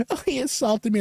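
high mocking imitation of a woman complaining; accusing woman. Woman complains to store employees, high accusing mimicry. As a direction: mimic her tattling in a high voice.

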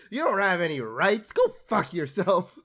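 mock the entitled crowd; mock sarcastic rant. Mocking anti-vaxxer complaint.

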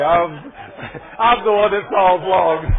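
real amused confession; laughing admission. Real, not acted.

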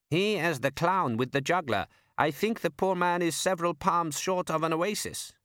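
a lightly voiced desert man; foreign man. Character voice.

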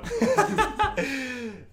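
high, loud cackling laugh. Real, not acted. Host laughing at colleague's taste for Seagal films, loud high laugh. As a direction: crack up loudly, up high.

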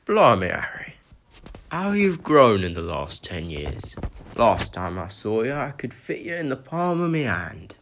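put-on a friendly giant marvelling at the grown boy; Hagrid's voice.

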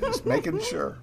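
very high, soft dog voice. Pretend. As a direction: squeak it like the dog answering. Voicing the dog's reply.

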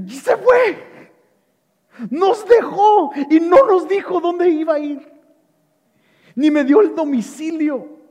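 high, alarmed disciples in a panic; discípulos preocupados. Acted. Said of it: "preocupados, los discípulos unos los otros". (discípulos alarmados, voz aguda)

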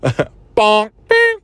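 laughing, high cartoon bonk and bing; Three Stooges sound effects. Second try at Three Stooges sounds, high goofy cartoon noises. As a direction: squeak out a slapstick bonk noise. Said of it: "That's not what the Three Stooges sounds".

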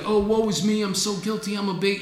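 mock woe. Mocking a wallowing guilty believer, lightly whiny. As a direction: play the wallowing sinner, hammed a bit. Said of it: "You can't be like".

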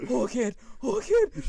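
high, loud helpless cries; hammed-up agony, wailing it out; pained wailing. Wife unable to move in cramp, acted pained wail.

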